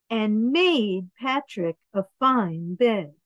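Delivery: ordinary delivery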